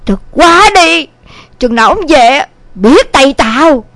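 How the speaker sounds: understated, indignant threats; fuming mother. A narrator's reading. Mother disowns son and blames his father, moderately angry. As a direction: vow to disown a son, grumbling angrily. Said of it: "Thấy mẹ bốc hỏa giận dữ".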